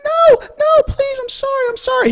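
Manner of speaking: very high, loud panicked begging; shrieky imitation of a terrified woman; imitated pleading girlfriend. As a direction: plead for your life in a mock falsetto. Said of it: "you hear the girl".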